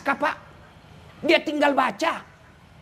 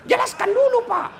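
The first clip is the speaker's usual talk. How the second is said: mock demand; 10 semitones higher than usual. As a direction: bark it out like a fed-up boss. Acted demand in a retold exchange.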